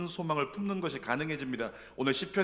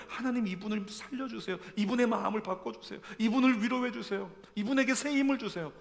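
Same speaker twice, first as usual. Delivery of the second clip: higher-pitched voiced intercession; mock prayer; 7 semitones higher than usual. Believers praying over a suffering member, lightly voiced pleading.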